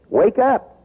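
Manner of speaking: ordinary talk, nothing marked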